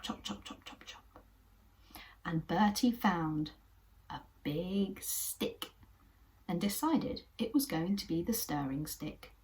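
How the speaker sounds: usual speaking voice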